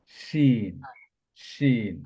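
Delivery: "শিন" with a full hushing SH, drawn out evenly; mid-tongue to palate for the SH in "শিন". Shown as a demonstration. Teacher models the next letter, "শিন" held long with SH. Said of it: "আমরা pronounce করব".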